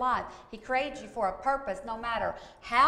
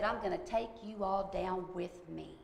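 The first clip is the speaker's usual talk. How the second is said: softer, lower retold threat; lightly imitated demon voice; 4 semitones lower, 6 dB quieter than usual. Retelling the demon's threat to mother and family, subdued low imitation. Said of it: "this voice came up out of her and said".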